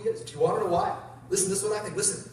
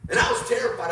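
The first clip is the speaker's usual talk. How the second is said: ordinary delivery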